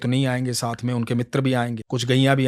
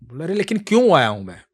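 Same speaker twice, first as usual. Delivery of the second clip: higher, louder bickering; quarrel; 4 semitones higher, 5 dB louder than usual. Acted. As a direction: argue back and forth. Quarrel while walking.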